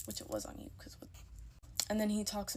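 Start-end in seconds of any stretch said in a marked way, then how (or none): 0.1-1.1 low whispered jab; whispered aside. Real, not acted. To the viewers, a whispered snide aside. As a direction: hiss it under your breath. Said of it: "whispered aside".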